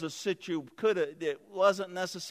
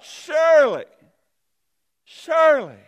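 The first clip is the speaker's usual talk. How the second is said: pleading cry; 10 semitones higher, 14 dB louder than usual. Acted. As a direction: call it out loud in desperate hope. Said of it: "he thought".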